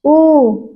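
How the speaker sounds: ऊ held long and round from the back of the tongue; demo vowel. Shown as a demonstration. Teacher demonstrating a back vowel for students. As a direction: sound out ऊ slowly for the class. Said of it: "आप बोलिए आ".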